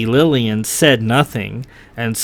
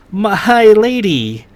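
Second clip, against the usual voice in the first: cheerful; 8 semitones higher, 3 dB louder, slower than usual. A narrator's reading. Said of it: "he said in a cheerful voice".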